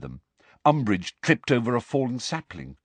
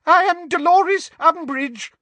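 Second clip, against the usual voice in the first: very high, loud, frightened voice; terrified official, pitched right up; 7 dB louder than usual. A narrator's reading. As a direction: announce yourself in shrill terror. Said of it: "in a high-pitched, terrified voice".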